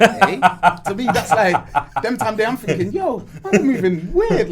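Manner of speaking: high, laughing retelling; laughing through the words, voice up high. Real, not acted. Laughing as he recalls classmates watching EastEnders.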